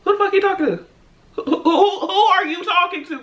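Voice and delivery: retold who-do-you-think-you-are attitude; indignant. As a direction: stammer into an affronted retort. Her indignant comeback, retold.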